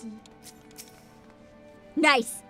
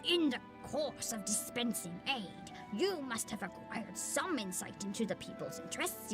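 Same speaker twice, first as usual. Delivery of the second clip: put-on old man voice; 5 semitones lower, 9 dB quieter than usual. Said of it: "You love my old voice".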